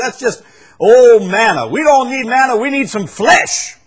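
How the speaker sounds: high, loud scornful parody; shouted mock demand; mocking quote. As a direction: ridicule their craving.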